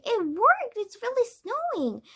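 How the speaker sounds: high, thrilled exclamation; bursting with excitement at real snow; excited cry. A narrator's reading. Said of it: "cried Heidi".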